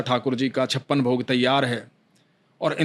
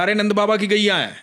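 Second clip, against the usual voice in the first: put-on higher, eager boy's warning; young cowherd boy; 5 semitones higher than usual. A narrator's reading. Cowherd boy warns the old man about the cows, higher voice. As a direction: warn him like a cowherd lad.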